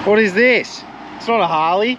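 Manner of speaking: who brought the wrong bike, high surprise; surprised. Real, not acted. Seeing his mate's new bike, surprised loud question. Mate turns up on different bike.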